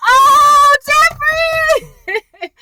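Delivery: very high, loud drawn-out exclamation; hyped-up shock. Retold or imitated. Viewer reacting to Jeffrey's comeback, gleeful shock. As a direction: whoop it like a spectator at a great burn. Teller's delighted shock at a burn.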